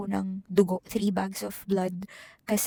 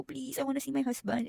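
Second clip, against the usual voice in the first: softer, tearful please; 5 semitones higher, 5 dB quieter than usual. Retold or imitated. Crying patient asking nurses to see her husband, soft tearful plea. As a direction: plead quietly through tears. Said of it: "umiiyak ako".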